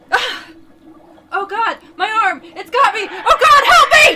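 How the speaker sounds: high panicked screams for help; terrified screaming. Acted. Mike screaming as the creature bites him. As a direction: shriek like something has your arm.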